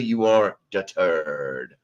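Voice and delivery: slow, stretched-out announcer call; drawn-out announcer. Acted. Host crowning Bradley third in chat, slow mock-announcer drawl. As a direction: drag each word like a game-show host.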